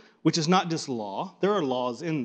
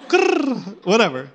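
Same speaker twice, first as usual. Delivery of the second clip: much higher coo, coo of a pigeon; 5 semitones higher, 5 dB louder than usual. Shown as a demonstration. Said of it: "they're cooing".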